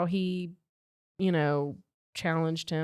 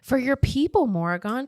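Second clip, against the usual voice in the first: higher, louder acted protest; insistent heroine; 8 semitones higher, 5 dB louder than usual. A narrator's reading. Rissa answering Morrigan about his people, insistent. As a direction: read her reply with raised, urgent insistence.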